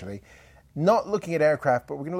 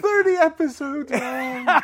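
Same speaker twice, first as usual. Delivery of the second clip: laughing groan; 5 dB louder, slower than usual. Real, not acted. Host realizes he mispronounced name.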